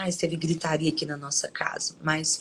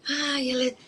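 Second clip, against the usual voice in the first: mock meek voice; 7 semitones higher, much faster than usual. Describing people thought kind for talking meekly, mock-sweet high voice. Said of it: "ela fala assim, tão mansinho".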